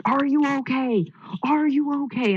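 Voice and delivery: high-pitched urgent check, no real volume; imitated yelling. Volunteer to arriving runner at the cold finish, high mock yell. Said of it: "like, yelling at me".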